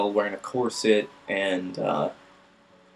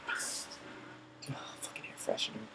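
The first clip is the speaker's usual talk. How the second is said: whisper; 19 dB quieter, slower than usual. Retold or imitated. Muttered complaint.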